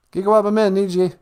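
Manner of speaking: ordinary delivery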